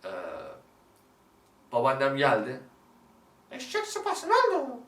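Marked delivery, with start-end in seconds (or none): 3.5-4.8 high-pitched, loud grandma impression; scolding grandmother. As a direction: snap at the child in a high, raised voice. Acted grandmother scolding the child. (azarlayan babaanne taklidi)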